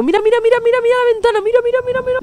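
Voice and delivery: high, urgent frightened calls; panicked repeats. Real, not acted. Gamer urges his friend to look, panicked high calls. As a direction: keep pointing in panic.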